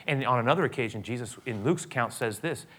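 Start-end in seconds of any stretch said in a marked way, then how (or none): none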